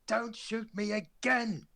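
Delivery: high frantic yelling, slow and drawn out; panicked shout. Acted. Character begging the guard not to shoot again, panicked. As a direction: plead in a panic not to be shot.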